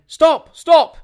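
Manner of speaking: loud, high shout of alarm. A narrator's reading. Doctor shouting to stop the duel, loud high yell. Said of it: "and finally shouted".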